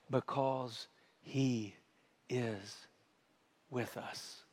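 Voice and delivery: drops to a soft, slow near-whisper; hushed. Real, not acted. Pastor answering why to the church, hushed and slow. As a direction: let it sink in, barely above a breath.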